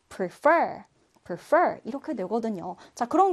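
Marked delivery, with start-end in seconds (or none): 0.1-0.8 "prefer" with the last syllable stressed; stress on second syllable. Shown as a demonstration. Teacher showing a verb's stress, "prefer" stressed at the end. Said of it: "강세가 뒷 음절에 있습니다". 1.2-1.8 "prefer" with the last syllable stressed; stress on second syllable. Shown as a demonstration. Teacher showing a verb's stress, "prefer" stressed at the end. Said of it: "강세가 뒷 음절에 있습니다".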